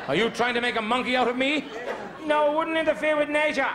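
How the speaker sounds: hammed-up classroom scene; teacher and pupils. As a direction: bark teacher lines, chirp the answers.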